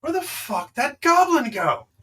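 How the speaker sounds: high, loud snarl of a searching ghost; angry ghost. Acted. GM voicing the ghost hunting the goblin, loud angry call. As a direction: bellow it like a furious spirit. GM voices the spectral character.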